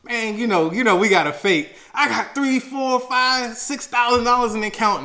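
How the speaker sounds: defensive bragging. Retold or imitated. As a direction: puff up, insist you're loaded. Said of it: "super defensive".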